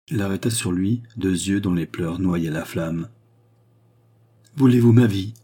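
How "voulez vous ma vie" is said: higher, louder desperate cry; distraught. A narrator's reading. Charny offering the queen his life, distraught. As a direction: offer your life, beside yourself. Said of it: "dit-il éperdu".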